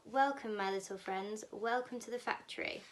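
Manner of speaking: usual speaking voice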